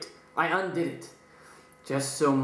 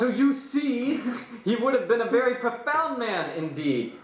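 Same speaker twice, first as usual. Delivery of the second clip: mad narrator; 7 semitones higher than usual. Acted. Dramatic reading, laugh.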